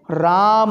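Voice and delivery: "राम" with both vowels long, the last held; sustained three-beat ending on "राम"; calling someone, prolonged. Shown as a demonstration. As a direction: call "राम", stretching the final "a". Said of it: "पुकार रहे हैं हम किसी को".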